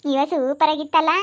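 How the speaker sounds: low, gloating ghost voice; scheming ghost. Acted. Ghost calling its friend to stir up the women, low and gloating. Ghosts praise each other.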